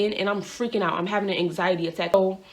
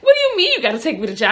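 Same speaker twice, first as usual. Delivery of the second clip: high, loud, fast protest; incredulous outburst; 8 semitones higher, 6 dB louder, faster than usual. Retold or imitated. Driver to officer who must take her to jail, incredulous outburst. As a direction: burst out in acted disbelief.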